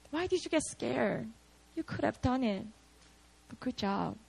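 soft, understated reassurance; gentle encouragement. Acted. Jesus consoling Peter after he sank, gently reassuring. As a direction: let it drop to a quiet, kind consolation. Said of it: "that's what Jesus was saying to Peter".

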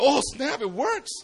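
acted thrilled surprise; amazed discovery. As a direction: blurt it in delighted astonishment. Student discovers the moves work.

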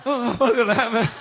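high, loud mock-fearful voice; hammed-up haunted-house jitters; mock scared. Preacher imitates newcomers entering church like a haunted house, mock scared. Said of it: "they're crying because they're scared".

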